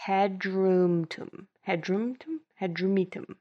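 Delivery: Hadrumetum sounded out, had-rum-tum; practising the name. Shown as a demonstration. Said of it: "seventeen M's in this word".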